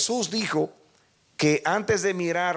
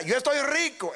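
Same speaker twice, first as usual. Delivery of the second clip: mocking proud church; 11 semitones higher than usual. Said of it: "ella decía".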